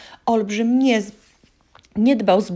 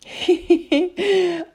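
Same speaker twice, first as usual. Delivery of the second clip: amused laugh; 13 semitones higher, 5 dB louder than usual. Real, not acted. Narrator laughs.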